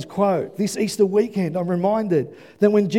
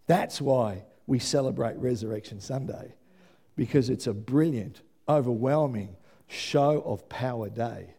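soft prayerful close; 10 semitones lower, 7 dB quieter than usual. Real, not acted. Quiet prayerful close of sermon.

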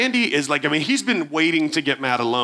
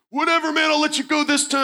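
fake tough bravado; 12 semitones higher than usual. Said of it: "I'm like".

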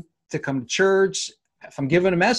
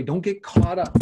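excited; 3 semitones higher than usual. Real, not acted. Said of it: "You got so excited".

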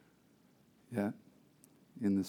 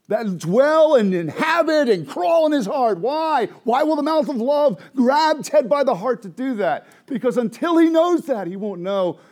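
full-force preaching; 12 semitones higher, 12 dB louder than usual. Real, not acted. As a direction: cry it out to the congregation.

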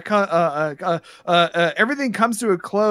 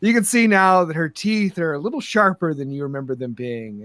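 normal talking voice

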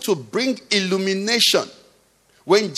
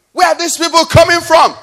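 high, loud rhetorical question; raised preaching shout; exasperated outcry; 10 semitones higher, 7 dB louder than usual. Real, not acted. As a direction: cry it out to the congregation in disbelief.

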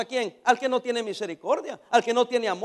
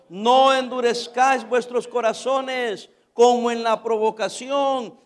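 loud, slow declamation of scripture; preached scripture reading; 6 dB louder, slower than usual. Acted. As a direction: proclaim the verse, stretching key words. Reads scripture to congregation. (lectura bíblica predicada con fuerza)

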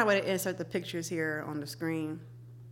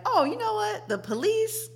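mock naive voice; 12 semitones higher than usual. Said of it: "isn't gonna make me like".

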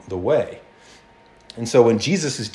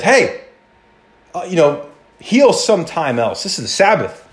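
raised, higher protest of a fussy official; mock indignant ruler; 6 semitones higher than usual. Synagogue ruler scolding Jesus for healing, mock indignant. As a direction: object like a rule-bound official, a bit higher.

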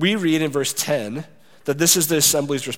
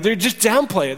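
ordinary delivery

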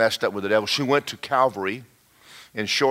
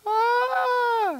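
high-pitched mock demon shriek; imitated scream. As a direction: shriek like someone possessed. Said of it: "She starts screaming".

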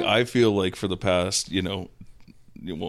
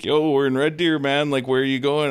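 raised, louder voice calling him awake; mock wake-up call; 7 semitones higher, 4 dB louder than usual. Bandmates rousing a passed-out friend, loud call. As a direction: shout him awake in the van. Band guys waking him in van.

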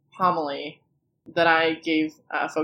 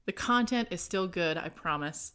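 normal talking voice

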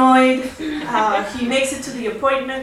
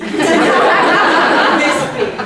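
laughing as she says it; amused burst of laughter; 6 semitones higher, 6 dB louder than usual. Real, not acted. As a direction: laugh through the words. Showing cup size, laughing.

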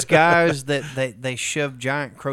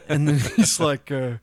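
ordinary talk

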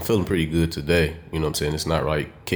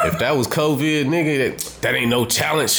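hyped boast; 6 semitones higher than usual. Pretend. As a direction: pump yourself up like a champ. Boasting like a champion fighter.

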